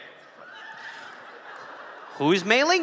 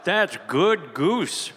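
slow savoring; 4 dB quieter, much slower than usual. Acted. S0 savours the goose in character.

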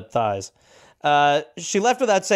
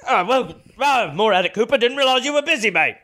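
mock sergeant; 7 semitones higher than usual. Answering Cooper's excuse as the sergeant, higher mock British. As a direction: play the fooled sergeant, mock polite.

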